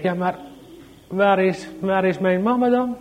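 put-on high child's voice asking for mama; small boy asks the stranger where mama is; anxious little child. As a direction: plead like a small lost boy.

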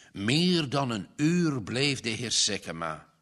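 usual speaking voice

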